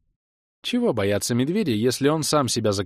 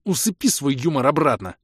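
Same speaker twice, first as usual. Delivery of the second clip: higher, irritated order; barked reprimand; 5 semitones higher, 3 dB louder than usual. A narrator's reading. Leader shutting down Kray's humour, barked reprimand. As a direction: snap the order at him. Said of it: "рявкнул я".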